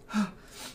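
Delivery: a breathless startled gasp; sharp gasp. Acted. Mother gasping at the strangers, startled. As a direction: catch your breath in surprise.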